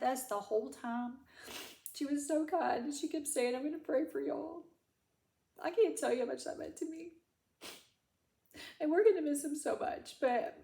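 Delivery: pitched high, a tearful voice; crying. Real, not acted. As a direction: speak through real tears about the vet tech. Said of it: "I'm crying through this whole thing".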